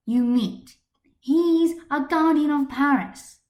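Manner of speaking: loud, high, heated defense; fierce defense. Acted. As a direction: fight for her partner out loud. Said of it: "her ire to defend her partner".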